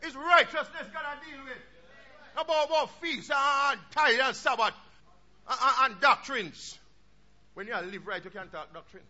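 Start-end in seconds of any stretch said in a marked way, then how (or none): 0.0-6.8 loud, ringing pulpit voice; full-force sermon delivery; fiery preaching. Acted. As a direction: preach it out to the congregation.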